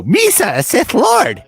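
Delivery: high, loud goofy alien villain; Jar Jar-style voice. Acted. Villain revealing himself as Sith, high goofy alien. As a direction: burst out in a silly high pitch. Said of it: "sound like that".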